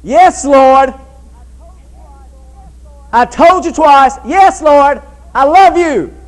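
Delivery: loud, high, exasperated outburst; agitated. Acted. Peter protesting to the Lord's repeated question, agitated. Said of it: "Peter got agitated about this".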